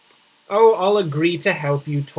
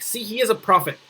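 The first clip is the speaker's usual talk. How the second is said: normal talking voice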